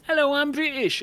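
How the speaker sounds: high, loud mock posh British "Hello, I'm British"; British accent, high and loud, hammed up. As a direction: ham up a prim British accent. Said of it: "juxtaposition to Keira Knightley's".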